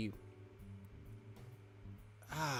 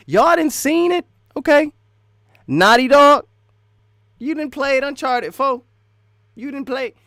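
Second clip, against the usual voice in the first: high, very loud hollering; hyped-up shouting; 11 semitones higher, 14 dB louder than usual. Real, not acted. Gamer challenging viewers about Naughty Dog's record, hyped-up shouting. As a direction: holler it at the audience.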